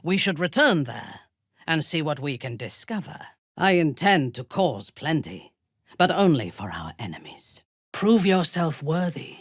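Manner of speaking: ordinary talk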